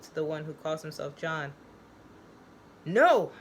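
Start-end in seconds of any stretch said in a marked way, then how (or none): 2.8-3.3 higher, louder shouted no; scream. Acted. Joe screaming at Tommy's claim, loud no. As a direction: scream it out in horror. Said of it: "he screams".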